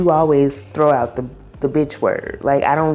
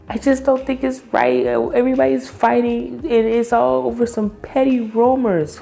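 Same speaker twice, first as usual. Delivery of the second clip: much higher, whiny mock-crybaby voice; mock tearful whine; 9 semitones higher than usual. Vlogger mocking a crying castmate's complaint, high whiny mock voice. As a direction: pitch it way up like a teary complainer.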